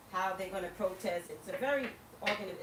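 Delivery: ordinary delivery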